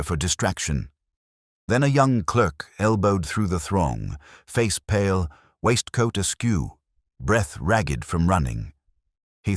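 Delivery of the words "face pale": usual speaking voice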